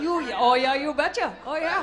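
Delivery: rounded Minnesotan O's; playful Minnesotan echo; Minnesotan accent. Retold or imitated. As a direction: mimic a sweet Minnesotan local. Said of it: "They round their O's".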